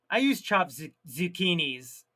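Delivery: ordinary talk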